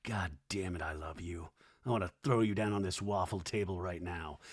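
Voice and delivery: low, hushed confession dropping to a whisper; mock smitten aside. Podcaster voicing Luke's thoughts looking at Doty, hushed and low. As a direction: murmur it low, as a secret thought. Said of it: "looking at Doty uncomfortable, but also like".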